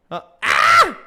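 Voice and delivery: sharp startled yelp, high and loud; sudden yelp. Real, not acted. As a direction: let out a sudden high cry. Sudden outburst mid-stream.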